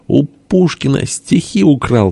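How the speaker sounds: high, drawn-out accusing cry; heated cry. A narrator's reading. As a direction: call out the accusation without real loudness. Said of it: "крикнул тоже и Бенедикт, распаляя сердце". (запальчивый выкрик, высоко и протяжно)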